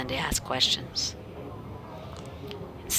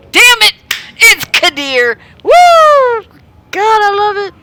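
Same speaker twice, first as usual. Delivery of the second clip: much higher and shouted with glee; real excitement; 19 dB louder, slower than usual. Real, not acted. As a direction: burst out thrilled at the character. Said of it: "Sorry for my excitement".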